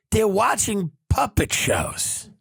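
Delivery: normal talking voice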